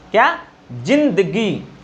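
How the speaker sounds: ordinary talk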